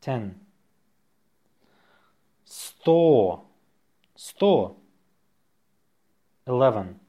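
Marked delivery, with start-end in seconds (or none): 2.4-3.5 slow, stretched-out dictation. Shown as a demonstration. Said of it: "say it slowly". 4.1-4.8 brisk dictation repeat; faster. Shown as a demonstration.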